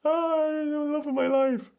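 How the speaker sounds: very high, slow sing-song; camera-on gush. Pretend. Couple switching on for the camera, very high and slow. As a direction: beam it out for the camera. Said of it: "As soon as the camera turns on".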